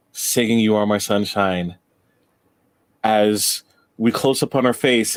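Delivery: ordinary talk, nothing marked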